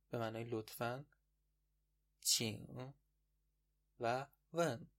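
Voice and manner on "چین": normal talking voice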